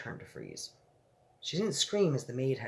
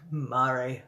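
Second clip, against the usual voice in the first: Mary said through a real laugh; 5 dB louder than usual. Real, not acted.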